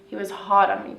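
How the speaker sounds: normal talking voice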